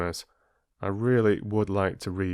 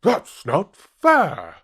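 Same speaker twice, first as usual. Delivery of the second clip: slow, high, louder whiny dog voice; jealous dog; 10 semitones higher, 7 dB louder, much slower than usual. Acted. Greedy dog sees his reflection, slow high indignant whine. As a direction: drag it out like a sulking dog. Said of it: "he thought".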